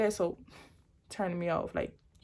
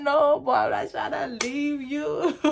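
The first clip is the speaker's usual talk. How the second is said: higher, louder, tearful pleading; crying plea; 6 semitones higher, 4 dB louder, slower than usual. Retold or imitated. Crying girlfriend pleading with the boyfriend holding a knife. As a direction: beg him through tears. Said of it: "I was crying".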